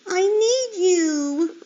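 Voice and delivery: high, slow, loud plea; child pig begging his mother; pleading. Acted. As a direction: draw it out, begging.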